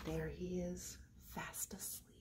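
hushed unvoiced whisper. Teacher whispers to the kids that the boy sleeps. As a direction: whisper so he stays asleep.

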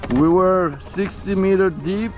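usual speaking voice

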